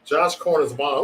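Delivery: ordinary talk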